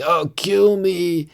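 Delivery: high, drawn-out mock plea; hammed-up begging, very slow and high; zombified mayor plea. Mayor turning zombie begs Nathan Fillion, mock pleading. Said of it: "he's starting to be zombified".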